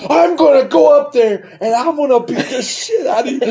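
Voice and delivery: high, laughing hammed-up threat; dad threatening. Son imitating his dad's threat under the truck, high and laughing. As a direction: threaten like a mock angry dad, laughing through it.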